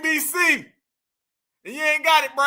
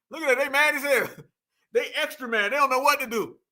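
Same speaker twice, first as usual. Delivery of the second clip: ordinary talk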